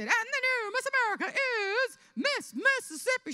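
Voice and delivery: high, loud pageant announcer; hammed-up crowning announcement; Bert Parks. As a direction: proclaim the winner, drawing out the suspense. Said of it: "then Bert Parks said".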